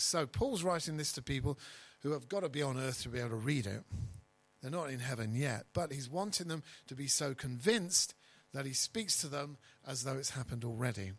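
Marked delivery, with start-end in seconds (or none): none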